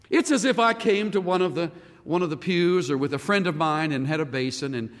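ordinary talk, nothing marked

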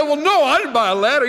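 a fed-up customer; widow customer. Acted. Widow answers the pet store owner, exasperated.

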